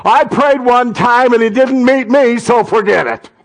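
high, stretched-out mock complaint; indignant quitter. Someone who prayed once and quit, mock indignant.

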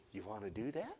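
soft, sweet invitation with a little laugh. Shown as a demonstration. Pastor sweetly asking the congregation, soft and gentle. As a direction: coax them gently, half laughing. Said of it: "I'd say it's sweet".

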